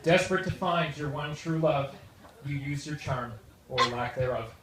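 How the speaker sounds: light rhyming verse read aloud; wedding toast poem. Acted. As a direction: recite a rhyming poem with comic timing. Reads his rhyming wedding poem.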